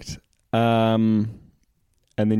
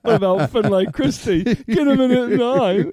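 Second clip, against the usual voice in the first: laughing joke; 7 semitones higher than usual. Real, not acted. Joking about gingers, laughing.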